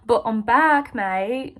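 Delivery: badly faked British-Australian accent; mock British-slash-Australian twang. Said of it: "that's a bad accent".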